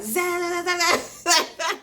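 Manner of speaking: high, drawn-out buzzing zaps; loud comic electric zapping; imitated taser zap. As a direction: mimic a crackling taser with the voice.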